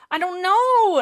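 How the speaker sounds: loud, high, drawn-out wail; hammed-up helpless outburst; exaggerated frustration. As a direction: cry it out in mock exasperation. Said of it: "it frustrates me".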